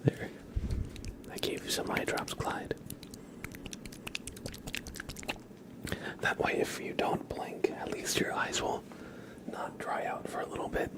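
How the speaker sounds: soft whispered pretend care; ASMR whisper. Real, not acted. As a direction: whisper while handing over imaginary eye drops. ASMR whisper, giving pretend eye drops.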